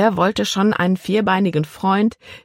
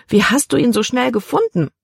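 higher voice, lightly played girl; questioning girl; 8 semitones higher than usual. A narrator's reading. Libby asks father about the dog, questioning. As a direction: ask it higher, a hint of disbelief.

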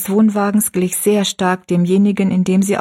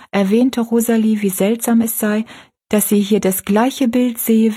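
plain reading voice

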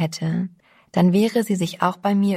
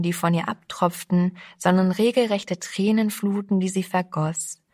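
usual speaking voice

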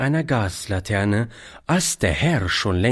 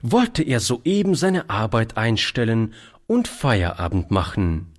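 plain reading voice

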